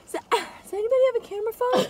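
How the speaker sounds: high, fast, flustered mom voice; imitating mom. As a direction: rush it like a frantic mom. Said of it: "my mom the other day was like".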